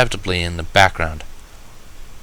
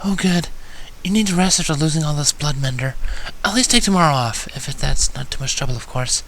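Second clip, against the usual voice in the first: much higher and softer, shy gentle mare; soft timid Fluttershy; 6 semitones higher, 4 dB quieter than usual. Acted. Fluttershy gently urges the bleeding Mender to rest, timid and soft. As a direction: coax him to rest, almost apologising. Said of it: "Fluttershy exhaled quietly".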